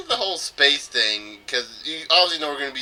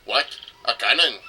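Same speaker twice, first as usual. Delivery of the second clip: mock Russian accent. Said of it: "it wasn't a Russian accent".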